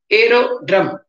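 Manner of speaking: AERODROME split into aero-drome; syllable by syllable. Shown as a demonstration. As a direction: break "aerodrome" into separate parts. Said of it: "split and write".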